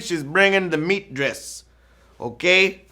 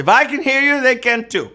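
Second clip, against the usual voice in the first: higher, loud; calling out to dad; 10 semitones higher, 4 dB louder than usual. Real, not acted. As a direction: call across to noisy dad.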